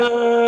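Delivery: high loud wail, mimicking a woman in labor; mock childbirth scream; imitated scream. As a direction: cry out like she's giving birth. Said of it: "she's, like, screams".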